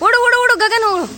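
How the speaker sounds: high, eager call to run; cheering call. Real, not acted. Host cheering Gagan to run for a chair, high excited call. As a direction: urge Gagan on, pitch up high.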